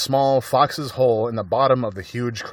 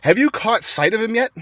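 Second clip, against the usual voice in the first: calling back; 10 semitones higher, 3 dB louder than usual. A narrator's reading. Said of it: "Bean called back".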